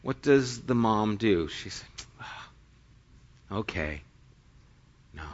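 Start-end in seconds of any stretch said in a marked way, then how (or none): 2.2-5.3 very soft, low, sighed resignation; meek giving up. Retold or imitated. Imagined mom accepting Jesus's refusal, meek giving up. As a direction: barely voice it, as if defeated.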